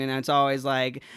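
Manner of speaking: ordinary talk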